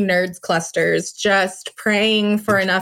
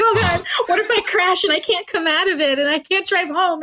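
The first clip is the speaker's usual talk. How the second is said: mock panic; 8 semitones higher than usual. Mock panic, laughing, high.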